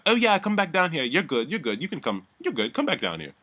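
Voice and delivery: high-pitched mock coaxing, easing down. [name] calling Lowton back downstairs, mock coaxing. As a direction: imitate someone beckoning in a high voice.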